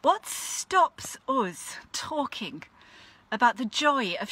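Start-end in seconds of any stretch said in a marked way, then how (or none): none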